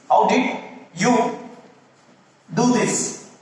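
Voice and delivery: slow, broken into two parts; slow spaced question. Shown as a demonstration. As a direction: spell it out slowly for students. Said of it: "you should ask, like, a question".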